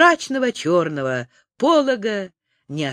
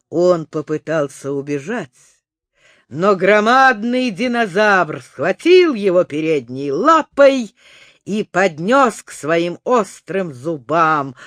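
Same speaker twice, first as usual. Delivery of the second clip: plain reading voice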